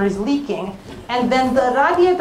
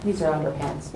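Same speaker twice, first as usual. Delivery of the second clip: mortified mutter; 10 semitones lower, 9 dB quieter than usual. Acted. Mortified repeat, flat low.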